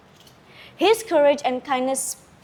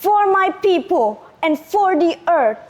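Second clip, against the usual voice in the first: higher, louder heroic cry; battle cry; 6 semitones higher, 5 dB louder than usual. Acted. Lagaligo cries out as he strikes the serpent. Hero strikes final blow.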